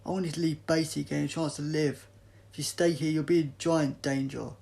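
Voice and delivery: loud, pressing plea; forceful urgent insistence; urgent warning. Acted. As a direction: raise the voice to make them listen. Doctor urges them to flee.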